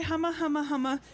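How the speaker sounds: ordinary delivery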